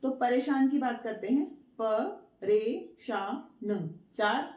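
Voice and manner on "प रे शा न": प रे शा न slowly, a pause after each; syllable by syllable. Shown as a demonstration. Teacher counting letters for pupils, प रे शा न broken up. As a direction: spell out प रे शा न in four separate sounds. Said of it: "चार अक्षर हैं इसमें".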